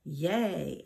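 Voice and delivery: mild narrated cheer; a contained boy's hooray; cheering shout. A narrator's reading. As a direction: cheer without really yelling. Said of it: "A J shouted".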